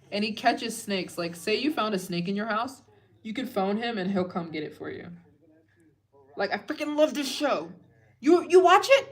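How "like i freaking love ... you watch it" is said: excited gush. Real, not acted. Gushing about favourite snake show.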